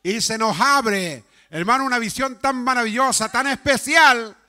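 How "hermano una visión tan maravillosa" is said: normal talking voice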